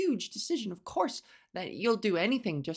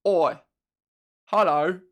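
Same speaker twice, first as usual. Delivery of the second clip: louder, indignant cockney rant; loud mock cockney outrage; cockney Jack the Ripper; 4 dB louder than usual. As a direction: Jack the Ripper taking offence, building up. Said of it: "Jack the Ripper was like".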